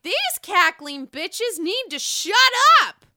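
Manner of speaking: mock yell, high and drawn out; Sonia. Sonia fed up with the noisy women, imitated loud outburst. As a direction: build to a loud, slow outburst on the last words. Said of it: "So Sonia's like".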